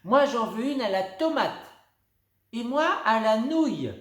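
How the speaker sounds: high loud playful order; deadpan prank flavours; cheeky girls. Acted. (fillettes espiègles, voix aiguë)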